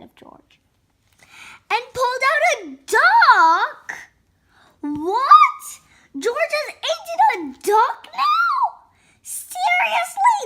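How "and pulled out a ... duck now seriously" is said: high, loud, squealed mock amazement; shocked disbelief. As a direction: exclaim it, utterly incredulous for effect. Shock at duck pulled out.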